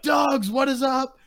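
high, loud, drawn-out hail; excited shouted greeting. Retold or imitated. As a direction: holler hello to someone you're thrilled to meet. Retold excited hail across street.

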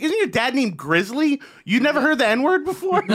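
very high mock disbelief; incredulous retort. Moderator doubting the wrestler's claim, high incredulous voice. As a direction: shoot up high in comic incredulity. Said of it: "I was like".

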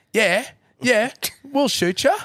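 high-pitched, gung-ho mock agreement; eager agreement. The mates answering a mad request, eagerly agreeing. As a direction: imitate the lads jumping at it.

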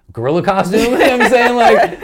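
high, loud, laughing incredulity; laughing disbelief. Real, not acted. Comic mocking gig demands, laughing high and loud. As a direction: crack up at the absurd demand.

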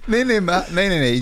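normal talking voice

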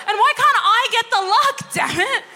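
high, loud, laughing outrage; loud high indignant rant with laughter; indignant outburst. Retold or imitated. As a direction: fire back at the absurd superstition. Indignant retort to Swiss family.

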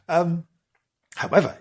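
ordinary talk

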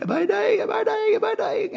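very high, repeated frantic question; panicked. Retold or imitated. Asks the hospital staff if he is dying, in a very high frantic voice. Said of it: "And I'm like".

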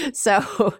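real chuckle at your own irony; amused admission through little laughs; laughing. Real, not acted. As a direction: talk lightly while breaking into laughter. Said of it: "I'm laughing because the next one".

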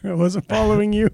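amused disbelief, laughing as you speak; laughing denial. Retold or imitated. Laughing at the neighbor's accusation.

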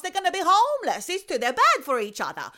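put-on high, loud Italian-accented pleading; agitated Italian ghost. Ghost nagging the agent in an Italian accent.

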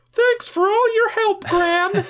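absurdly high, loud botched retake voice; Zippo. Acted. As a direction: squeal it out in a jarringly wrong character voice. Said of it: "the only line in the entire game in which he talks like that".

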